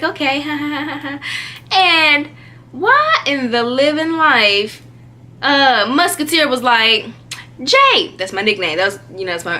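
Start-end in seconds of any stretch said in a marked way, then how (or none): 0.0-1.8 mock laugh. Acting out own uneasy laugh. 7.6-8.1 high-pitched call of a nickname. Retold or imitated. Boy calls his friend over, calling out.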